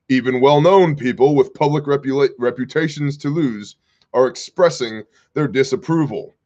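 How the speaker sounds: ordinary talk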